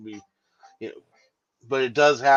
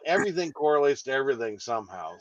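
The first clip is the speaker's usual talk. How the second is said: ordinary talk, nothing marked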